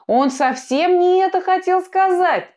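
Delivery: high, anxious protest; a high, flustered plea for his friend; defending a friend. A narrator's reading. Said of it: "начал заступаться за друга Сантьяго".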